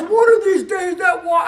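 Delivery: loud and high, like a playful threat; husband's mock warning shout. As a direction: bark the warning half-jokingly at your wife. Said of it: "And he goes".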